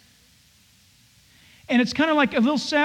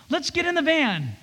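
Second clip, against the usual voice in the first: higher, softer, kindly asking; gentle invitation; 5 semitones higher, 4 dB quieter than usual. Shown as a demonstration. Father speaking to his children, gentle and loving. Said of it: "in a gentle way, in a loving way".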